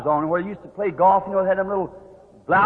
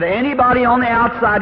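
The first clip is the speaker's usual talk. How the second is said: raised, projected public announcement; announcer's call; 6 semitones higher, 4 dB louder than usual. Retold or imitated. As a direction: page those outside, voice lifted. Acted announcer, second call.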